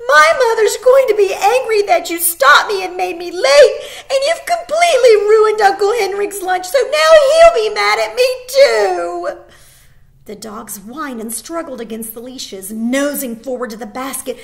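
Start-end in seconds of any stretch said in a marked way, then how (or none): 0.1-9.4 high, loud tearful wail; crying. Acted. Girl crying to the soldiers about the lunch. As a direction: sob it out in a rush. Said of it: "she began to cry".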